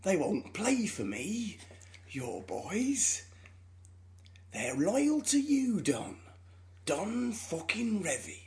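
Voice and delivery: put-on slow, drawn-out Northern drawl with a hushed edge on boys; half-whispered, pleading drunk Clough; Brian Clough impression. Drunk Clough ringing Don Revie late at night, slow Northern drawl. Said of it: "my impression of Michael Sheen doing an impression of Brian Clough".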